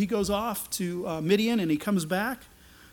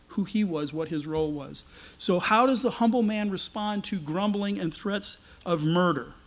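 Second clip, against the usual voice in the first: normal talking voice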